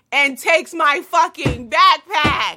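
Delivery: loud, slow, outraged shout; indignant shout. Retold or imitated. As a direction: yell it out in disbelief. Climax of retold theft.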